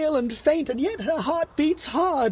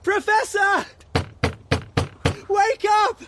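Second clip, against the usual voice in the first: frantic shouts for help; urgent calling; 6 semitones higher than usual. Acted. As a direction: wake the professor in panic. Husband calls professor urgently.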